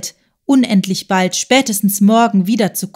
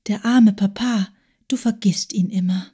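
soft reproachful whisper; voiceless whisper, gently chiding; 4 dB quieter than usual. Acted. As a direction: breathe it out as a quiet reproach. Agathe's reproachful aside.

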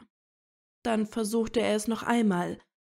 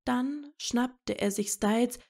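usual speaking voice

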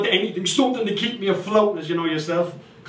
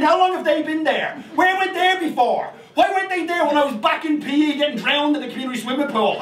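ordinary talk

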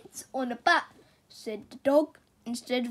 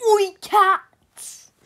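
loud, high title call; title shout; 7 semitones higher, 9 dB louder than usual. Acted. Reader to listening kids, calling out the book title loudly.

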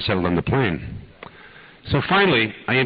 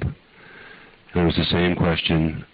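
normal talking voice

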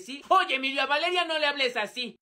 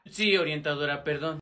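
much lower, mumbled student voice; sullen student; 11 semitones lower than usual. Acted. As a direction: grumble the apology in a deep put-on voice. Alumno regañado contesta a la orientadora. (alumno regañado, voz grave fingida)

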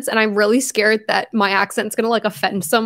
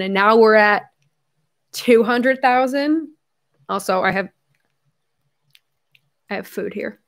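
normal talking voice

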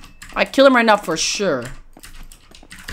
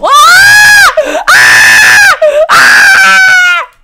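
raging screams; 9 dB louder, much slower than usual. Real, not acted. Player screaming after his death. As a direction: scream out in gaming rage.

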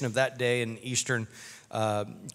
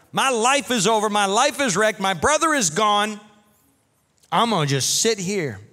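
higher, louder, ticked-off rant; an upset sister venting, higher and louder; fuming; 6 semitones higher, 4 dB louder than usual. Acted. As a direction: sulk and fume at Jesus, loud and high. Voicing angry, grieving Mary.

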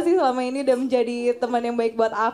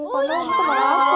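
emotional shout; faster than usual. Real, not acted. Tearful Valentine thanks to friend.

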